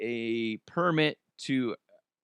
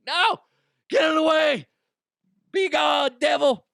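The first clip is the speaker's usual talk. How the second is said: high, loud panicked shriek; panicked shout; 6 dB louder than usual. Retold or imitated. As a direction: scream the snake away. Panicked at snake on beach.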